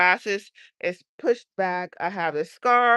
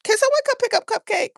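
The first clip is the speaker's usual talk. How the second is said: calling out; 4 dB louder, faster than usual. Real, not acted. Calling out about the cat.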